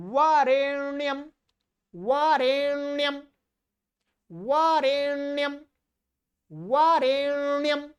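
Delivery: वारेण्यम intoned with a long-held 'n'; correct nasal retroflex. Shown as a demonstration. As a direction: drill वारेण्यम on a level note, no 'd'. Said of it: "ण है ये". (वारेण्यम में लंबा ण)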